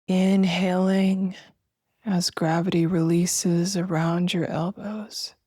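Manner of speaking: slow, spacious meditation voice with long pauses; guided meditation. Acted. Host guiding listeners to feel breath and weightless arms, slow meditation voice. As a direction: guide them gently through each breath, drawing words out.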